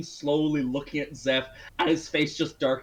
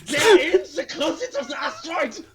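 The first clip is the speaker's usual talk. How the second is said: mock German accent; 12 semitones higher, 7 dB louder than usual. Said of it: "This accent".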